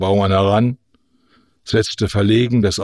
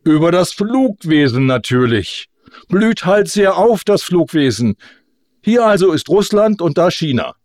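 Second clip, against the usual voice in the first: high-pitched, mildly indignant insistence; a touch of huffy orator; 7 semitones higher, 3 dB louder than usual. A narrator's reading. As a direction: press on with the speech, affronted. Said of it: "erklärte Kosonossow empört".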